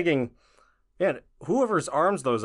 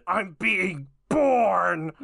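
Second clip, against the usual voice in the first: newborn goblin voice; 6 semitones higher, much slower than usual. Pretend. Acting out the goblin birth.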